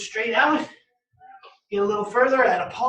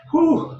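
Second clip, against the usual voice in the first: relieved whew; 6 semitones higher, 6 dB louder than usual. Real, not acted. Exhale after hard set.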